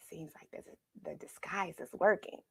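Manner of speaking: hushed conspiratorial whisper; whispered aside. A narrator's reading. Narrator whispering to young listeners, hushed and sly. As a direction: let the children in on a secret.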